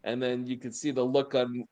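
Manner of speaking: normal talking voice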